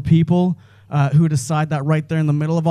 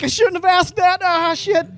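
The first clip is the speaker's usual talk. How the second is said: much higher, panicky mutter; panicked self-reproach; 12 semitones higher than usual. Retold or imitated. Comic regretting asking the dude, high panicky. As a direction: squeak it in sudden regret. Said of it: "I was like".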